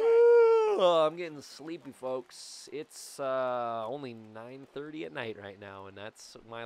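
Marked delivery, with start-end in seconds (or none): 0.0-0.8 tense yelp. Real, not acted. Reacting to a close pitch.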